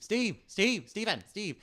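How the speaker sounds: high, loud repeated calls mimicking a prairie dog; comic high-pitched animal call; prairie dog imitation. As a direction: yelp the name like a little animal. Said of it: "the, the little prairie dog".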